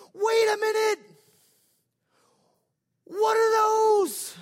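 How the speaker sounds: high, loud, slow astonished cry; amazed shout. Retold or imitated. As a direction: shout it out in wonder, stretching the words.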